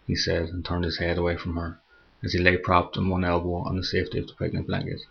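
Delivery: ordinary delivery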